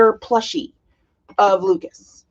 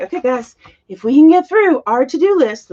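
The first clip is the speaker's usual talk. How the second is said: teacher pep talk; 5 semitones higher, 6 dB louder than usual. Retold or imitated. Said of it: "and they're like".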